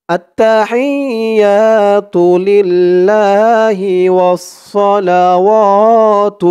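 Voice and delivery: التحيات لله والصلوات recited slowly and stretched; Arabic recitation, slow and clear. Shown as a demonstration. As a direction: recite each word slowly for learners. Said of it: "যেমন টান দিব".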